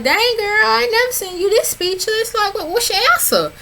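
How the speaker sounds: high playful imitation of Monty; mimicking Monty. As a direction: mimic Monty teasing her. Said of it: "And Monty was like".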